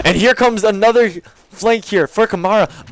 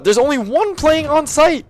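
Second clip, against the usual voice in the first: quick, raised play-by-play call; esports caster; 6 semitones higher than usual. Real, not acted. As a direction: call the round live, riding the action. Live play-by-play cast.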